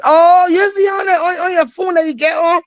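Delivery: put-on the boy asking, wide-eyed and eager; imitating a little kid. Little kid answers auntie.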